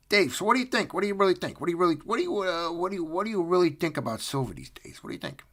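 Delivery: high, insistent questioning; mock interrogation. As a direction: badger him with stumbling repeats. Mock interrogation of imagined Dave.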